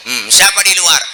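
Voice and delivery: voiced host calling from inside; sick man asking.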